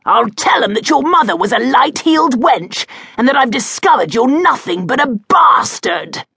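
high, loud spiteful rage; furious insult. A narrator's reading. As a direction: sneer the insult at full voice. Duke insults James's mother.

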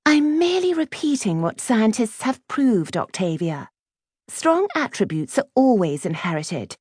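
high, prim know-it-all; self-righteous schoolgirl, pitched well up; snobbish Clementine. A narrator's reading. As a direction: lecture loftily in a high girlish voice. Voices Clementine lecturing Octavia.

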